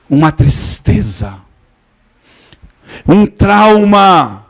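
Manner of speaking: slow, rising fervent sermon voice building up; preaching. Acted. Pastor preaches freedom to his congregation, fervent slow preaching. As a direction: proclaim it, stretching the key words.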